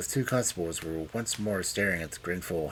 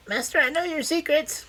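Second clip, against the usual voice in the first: very high squeaky mimicry; 5 dB louder than usual. A narrator's reading. Old man mimicking the sneak, squeaky voice. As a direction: squeak it out, pitched right up. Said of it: "say in a squeaky voice".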